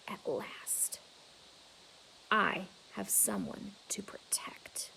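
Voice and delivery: slow hushed whisper; whispered resolve. A narrator's reading. Gorilla vows to protect Ruby, slow whisper. Quiet resolve after rage.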